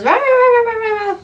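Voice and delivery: loud high mock barking; imitated dog bark. Host imitates her small dog charging men, loud high barks. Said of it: "she goes".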